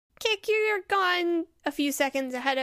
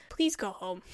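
lower-pitched, weary plea; really tired; 6 semitones lower, 4 dB quieter than usual. Acted. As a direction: say it worn out, pitch dropped. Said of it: "looks really tired".

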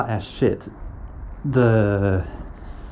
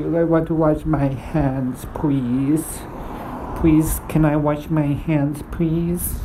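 pleading; 5 semitones higher than usual. Retold or imitated. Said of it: "I say".

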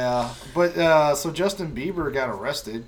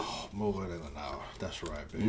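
sexy deep voice; 7 semitones lower, 8 dB quieter than usual. Pretend.

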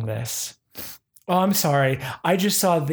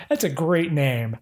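usual speaking voice

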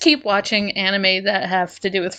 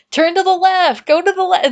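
higher-pitched mock calling out; imitated urgent kids; 7 semitones higher, 3 dB louder than usual. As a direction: lightly act kids urging someone along. Voicing kids calling out directions.

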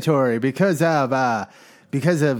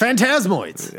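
excited; 7 semitones higher, slower than usual. Pretend. Echoes game name with mock fanfare.

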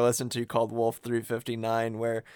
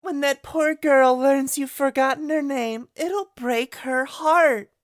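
high, slow sneering villain voice; Larxene voice; 14 semitones higher than usual. Retold or imitated. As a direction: taunt sweetly like a cruel villainess. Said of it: "Is what she says".

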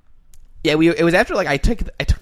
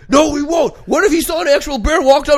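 high, loud mock-pedantic dad; dad's voice; 12 semitones higher, 8 dB louder than usual. Son mocking dad correcting toddler about Yogi Bear, high loud. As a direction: play the know-it-all father loudly. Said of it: "my dad's like".

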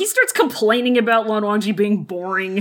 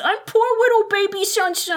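put-on much higher, cutesy baby voice; baby-talk whine; 9 semitones higher than usual. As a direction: talk like a pitiful little baby. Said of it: "translates as".

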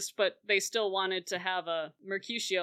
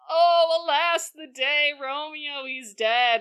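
loud, high mock lament; hammy acting; 5 semitones higher, 5 dB louder than usual. She fakes grief before both families, hammy. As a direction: overact the fake grief. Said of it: "She is acting just like I did".

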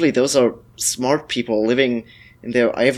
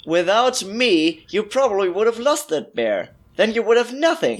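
indignant; 7 semitones higher than usual. Acted. Arguing with hunter.

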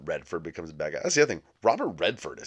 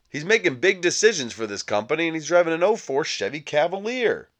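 higher and louder, mock outraged disbelief; incredulous rant; 9 semitones higher, 5 dB louder than usual. Real, not acted. Comedian ranting about the exec's cheap car, higher and louder. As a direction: pitch it up in comic indignation.

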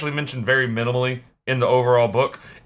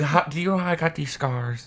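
Joker voice; 5 dB quieter than usual. Acted. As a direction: play the Joker, menacing. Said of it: "let me get my Joker makeup out".

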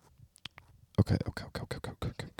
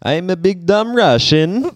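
higher, slow cartoonish Russian accent; mock Russian accent; 8 semitones higher, slower than usual. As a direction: do a silly, drawn-out Russian accent. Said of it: "Now it's Italian".

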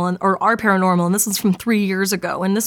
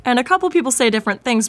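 normal talking voice

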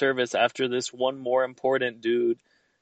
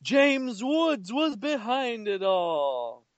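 dramatic reveal; 10 semitones higher, much slower than usual. Pretend.

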